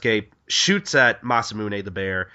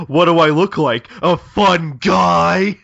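loud, high mock-outraged shout, last words stretched; Marinosuke; 6 semitones higher, 9 dB louder, slower than usual. Hunter snapping back with a pun, loud mock outrage. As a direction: bellow the pun, dragging it out. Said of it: "funnier if Marinosuke was like".

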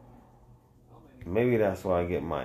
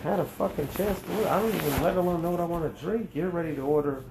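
higher, fast exasperated wife; wife complaining; 7 semitones higher, faster than usual. Retold or imitated. Wife complaining he orders too fast, high and quick. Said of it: "my wife's like".

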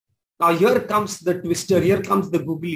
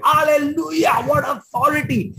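shouted hallelujah; 6 semitones higher than usual. Real, not acted. Preacher exulting at Jesus' authority, shouting. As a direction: shout it out in praise.

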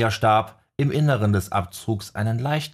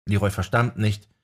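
plain reading voice

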